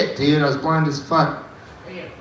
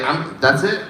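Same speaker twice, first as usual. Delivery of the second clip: normal talking voice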